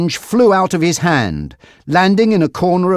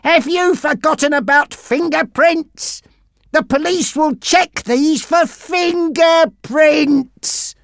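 berating; 13 semitones higher, slower than usual. Acted. Frankie berating Monty.

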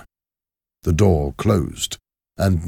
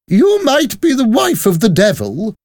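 very high, loud defiance; high-pitched, forceful challenge; defiant woman; 6 dB louder than usual. Acted. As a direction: stand your ground against an intruder. Miss Pross defies Madame Defarge.

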